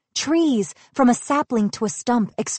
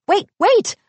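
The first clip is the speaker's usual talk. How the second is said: high, loud plea to stop; urgent cry; 11 semitones higher, 5 dB louder than usual. A narrator's reading. Asha running after Star, high urgent cry. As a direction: cry out, pitched way up. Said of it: "Asha cried".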